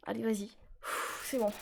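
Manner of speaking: hushed, near-whispered urging; under his breath. Real, not acted. Gamer mid boss fight, urging himself on, barely voiced. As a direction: mutter it to yourself, tense.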